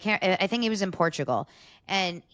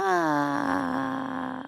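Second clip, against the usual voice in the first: long, higher-pitched "aah", held back; imitated muted crowd cheer; muted crowd scream; 6 semitones higher than usual. Describing the crowd's reaction, muted mock scream. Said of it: "much more muted".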